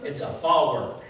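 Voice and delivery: fork stretched into two syllables, fa work; Southern drawl. Shown as a demonstration. Preacher showing his congregation the Southern fork. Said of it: "One syllable".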